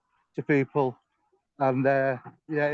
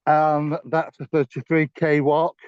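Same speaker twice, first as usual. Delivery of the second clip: ordinary delivery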